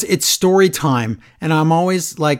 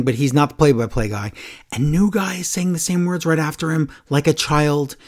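usual speaking voice

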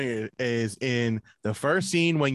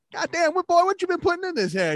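put-on high, loud old barber; 13 semitones higher, 4 dB louder than usual. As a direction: squawk it like a nosy old barber. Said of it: "He's like".

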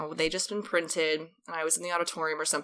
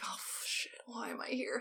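hushed, barely voiced dismay; mortified whisper; 5 semitones higher, 14 dB quieter than usual. Student realizes she is stuck there, mortified whisper. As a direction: swear under the breath in dismay.

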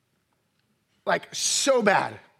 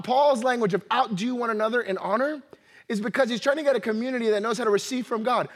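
ordinary delivery

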